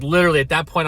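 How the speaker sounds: ordinary delivery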